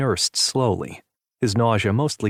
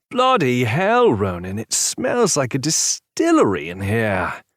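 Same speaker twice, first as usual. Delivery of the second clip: British friend voice; 3 semitones higher, slower than usual. A narrator's reading. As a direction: startle him with a cheeky jibe. Brad arrives, British exclamation.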